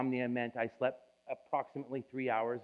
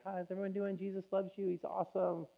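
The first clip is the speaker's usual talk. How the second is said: high, bright-on-the-surface pastor hello, much higher; forced happy welcome, pitched way up; fake cheerful greeting; 8 semitones higher than usual. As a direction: paste on a cheery smile while hiding tears. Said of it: "and just be like".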